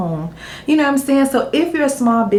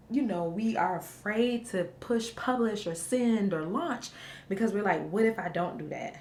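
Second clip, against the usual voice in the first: hushed; 12 dB quieter than usual. Real, not acted. Mom talks softly while her baby sleeps.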